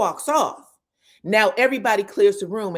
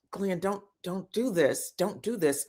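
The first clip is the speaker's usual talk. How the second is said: hushed plea; 2 semitones lower, 6 dB quieter than usual. Retold or imitated. Tisha pleading quietly with Glenn.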